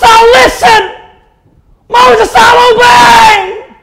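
high, full-force pleading scream. Acted. Korah begging Moses from hell, desperate screaming. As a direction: cry out desperately to be released. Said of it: "he heard somebody screaming".

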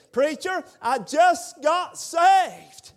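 loud, high, joyful retelling; preacher's voice climbs with the story; rising excitement. Acted. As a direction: let it lift into joy. Preacher retells Benny's joyful words.

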